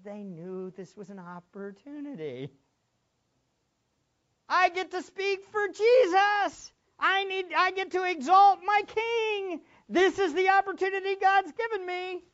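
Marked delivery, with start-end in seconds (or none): 4.5-12.2 eager apostle. Acted. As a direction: savour the chance like a thrilled believer. Voicing apostles' eager inner thoughts.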